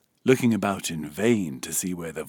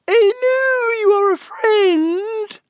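very high, slow, loud squeak; little voice; 10 dB louder, slower than usual. A narrator's reading. Gnat appealing to Alice, slow high little voice. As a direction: draw it out in a tiny creature's pitch. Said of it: "the little voice went on".

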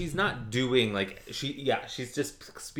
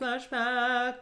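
high drawn-out announcement; much higher, mock-dramatic call; playful flashback cue; 14 semitones higher than usual. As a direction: sing out a silly scene transition.

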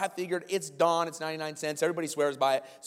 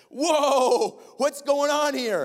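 high, loud, slowed whoa; hammed-up retold astonishment; startled boss; 12 semitones higher, 8 dB louder, much slower than usual. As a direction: react with big alarmed surprise. Boss's startled reaction to outburst.

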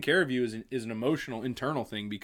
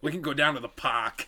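mock Boston accent, R dropped in park. Shown as a demonstration. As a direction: say park with a broad Boston vowel. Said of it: "do this Boston accent in Harry Potter".

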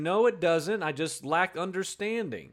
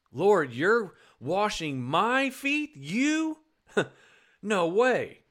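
slow, disbelieving, leaping up on You; incredulous Peter; 2 semitones higher, much slower than usual. Acted. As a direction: Peter cannot believe the master kneels. Preacher acting Peter's disbelief.

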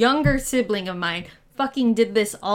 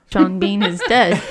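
louder, laughing echo; laughing repeat; 11 dB louder than usual. Real, not acted. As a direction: laugh it out loud.

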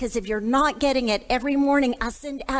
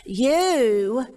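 "You" pressed out slow and firm; stress on you; 2 semitones lower, 3 dB louder than usual. Shown as a demonstration. Teaching the women to stress "You". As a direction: lean hard on "You". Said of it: "I want you to emphasize that word".

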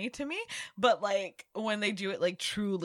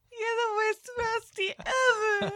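laughing; 3 dB louder than usual. Real, not acted. Laughing through fake crying.

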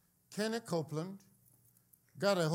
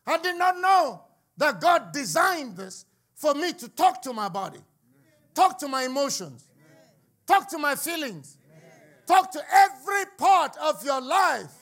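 loud, high declaration in driving repeated phrases; full-force preaching; 7 semitones higher, 10 dB louder than usual. Acted. Preacher declaring God's design to the congregation, full force. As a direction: punch each phrase, finishing on a shout.

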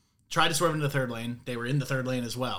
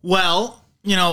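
loud, high angry burst; screaming; 6 semitones higher, 8 dB louder, slower than usual. Real, not acted. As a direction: still fuming, blurt it loud. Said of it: "You're screaming".

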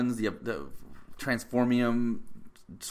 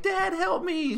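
much higher, whiny plea; mock helpless girl; slower than usual. Mocking the girl caught in the net, high whiny plea. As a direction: whine for daddy in a tiny voice. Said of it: "she's like".

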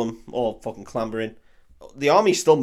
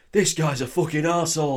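mock northern accent, pitched up; northern English accent; 5 semitones higher than usual. Teasing Faramir for not sounding like his brother, mock northern accent. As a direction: put on a broad northern accent. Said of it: "Where's your northern accent?".

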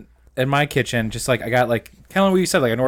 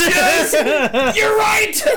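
shouting; 6 dB louder, much slower than usual. Pretend. Mock shouting back and forth.

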